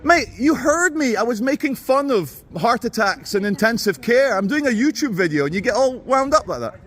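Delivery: high, incredulous protest; indignant arguing. Real, not acted. Arguing with the guards.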